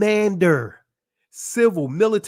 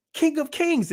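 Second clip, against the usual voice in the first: excited; 9 semitones higher than usual. Shown as a demonstration. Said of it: "Wait a minute".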